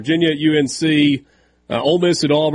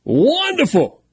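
mock delight; 11 semitones higher than usual.

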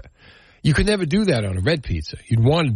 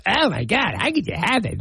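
high, gasping mock outrage; mock horror; 10 semitones higher than usual. Host mocking people shocked at eggplant pizza, high mock horror. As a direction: play the scandalized critic, high. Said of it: "mocking critics' reaction".

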